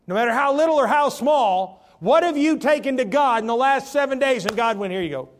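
high, loud preaching push; preaching at full force. Real, not acted. Preacher pressing the congregation about answered prayer, loud and high. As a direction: drive the question home loudly.

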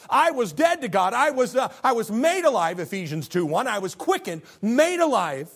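loud, high preaching build. Acted. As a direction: raise the voice like a revival preacher. Preaching at full force.

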